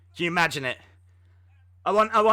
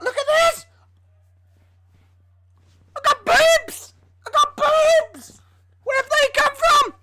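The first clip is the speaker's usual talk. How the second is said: high, loud, excited squeal; mock delight; 6 dB louder than usual. Streamer marvels at his pumped-up chest, hammed up.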